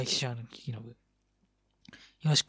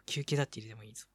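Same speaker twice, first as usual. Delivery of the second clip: quick, softer repeat; fast and soft; 2 semitones lower, 4 dB quieter, faster than usual. Shown as a demonstration. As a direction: rush it quietly. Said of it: "休憩もだって入れてもいいぞ。".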